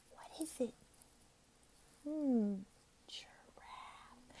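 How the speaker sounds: very soft, secretive whisper; whispered hint. Shown as a demonstration. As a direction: whisper the answer as a clue. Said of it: "If you need to whisper it".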